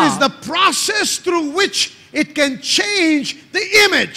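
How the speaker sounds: high, slow, forceful preacher delivery; emphatic preaching. Real, not acted.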